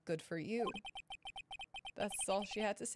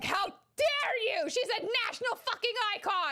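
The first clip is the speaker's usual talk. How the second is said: very high, loud indignant shriek; hammed-up fan fury; mock outrage; 13 semitones higher, 5 dB louder than usual. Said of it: "Everyone's like".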